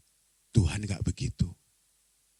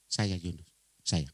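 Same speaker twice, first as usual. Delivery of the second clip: low, soft owning-up; meek admission; 5 semitones lower, 6 dB quieter, slower than usual. Acted. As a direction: answer small after being shouted at. Yunus mengaku setelah diteriaki. (mengaku pelan dengan suara rendah)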